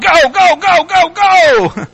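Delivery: high, hammed-up chant of go; mock urgent cheer. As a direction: playfully urge them on, pitch jumping up. Mocks an over-emphasis on go.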